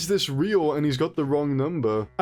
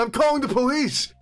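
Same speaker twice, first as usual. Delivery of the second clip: mock angry caller; 10 semitones higher than usual. Angry caller voiced in phone story.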